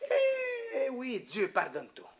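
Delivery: imitated French-accented priest, high drawn-out "eh"; priest's French accent. Priest assuring a groom of forgiveness, imitated French accent. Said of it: "the way he talks with that French accent".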